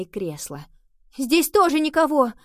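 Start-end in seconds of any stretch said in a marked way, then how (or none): 1.2-2.3 high, loud call across rooms; shouting. A narrator's reading. Nova answers Henry from another room, shouting. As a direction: shout the report back. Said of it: "прокричала она".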